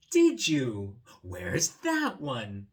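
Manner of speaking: loud, slowing question; eager boy. Acted. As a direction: ask it with big curiosity. Boy asking about cake.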